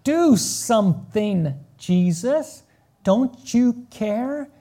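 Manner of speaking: slow, loud, stretched accusation; accusing plea. Retold or imitated. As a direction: plead and accuse, dragging it out. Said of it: "she's accusing Jesus".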